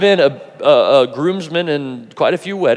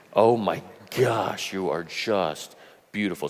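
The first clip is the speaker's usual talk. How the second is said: awed tenderness; 6 semitones lower, 8 dB quieter than usual. Retold or imitated. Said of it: "and I was like".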